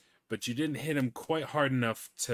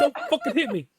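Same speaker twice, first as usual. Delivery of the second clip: mock scared yell; 7 dB louder, faster than usual. NPC voice after laughing.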